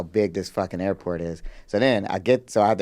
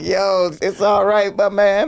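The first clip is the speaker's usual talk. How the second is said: drawn-out reassurance; 8 semitones higher, 4 dB louder, slower than usual. Retold or imitated. Retold reassurance to panicking passenger.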